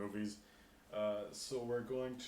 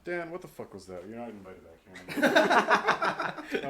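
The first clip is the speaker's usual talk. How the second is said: mock outrage; 9 dB louder than usual.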